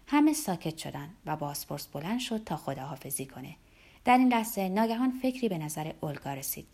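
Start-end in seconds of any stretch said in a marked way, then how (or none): none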